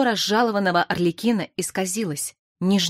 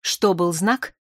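ordinary delivery, nothing marked

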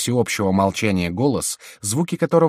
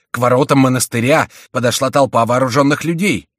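high, loud alarmed report; urgent cry; 5 semitones higher, 8 dB louder than usual. A narrator's reading. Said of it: "вскричал он, запыхавшись". (громко, с тревогой)

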